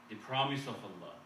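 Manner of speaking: ordinary talk, nothing marked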